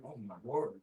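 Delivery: ordinary talk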